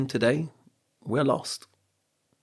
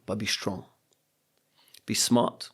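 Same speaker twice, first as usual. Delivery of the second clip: ordinary talk, nothing marked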